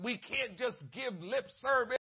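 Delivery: usual speaking voice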